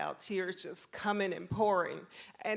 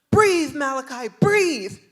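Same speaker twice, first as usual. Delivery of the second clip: loud, raised, drawn-out command; urgent officer; 7 semitones higher, 14 dB louder, slower than usual. Retold or imitated. Officer to boy in back seat, loud urgent order. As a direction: urge a panicking kid to breathe. Acted officer urging child to breathe.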